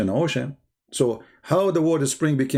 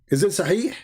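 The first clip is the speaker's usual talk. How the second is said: high, sarcastic "Is it sahih?" rising; mocking question; 5 semitones higher than usual. Real, not acted. Taunting viewers about the hadith's authenticity, high and sarcastic. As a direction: tease the audience with a high scornful question.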